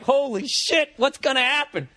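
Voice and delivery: high-pitched, acted-out amazement; thrilled suspense. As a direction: marvel at what comes next. Retold thought after button is hit.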